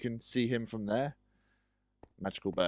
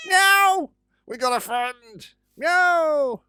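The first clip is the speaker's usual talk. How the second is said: high squealing meow; excited meows; 10 dB louder, much slower than usual. Pretend. A new friend at last, squealing meows. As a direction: squeal it like a delighted kid.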